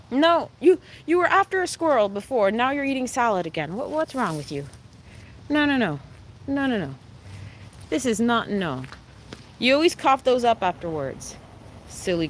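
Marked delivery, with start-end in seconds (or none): none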